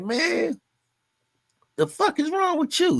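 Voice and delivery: high, incredulous outburst, voice jumping way up; can't believe the boss is smiling in this heat; exasperated disbelief. Retold or imitated. Worker to his grinning boss in the heat, high incredulous disbelief.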